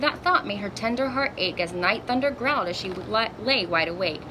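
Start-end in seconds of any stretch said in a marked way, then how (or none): none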